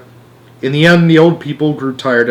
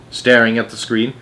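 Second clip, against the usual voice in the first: ordinary delivery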